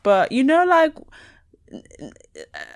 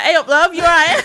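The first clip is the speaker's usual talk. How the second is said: broad Northern greeting, higher and louder; hammed-up Yorkshire "hey up, love"; loud, mock Northern accent, breaking into a laugh; 8 semitones higher, 8 dB louder than usual. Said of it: "I never sound like that".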